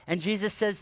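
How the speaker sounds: ordinary talk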